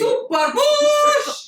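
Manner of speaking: high, drawn-out whoop; exclaimed outburst. Real, not acted. Co-host echoing the recruit's phrase, drawn-out high exclamation. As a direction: stretch it out in a high, amused cry. Blurts a mock cry at the phrase.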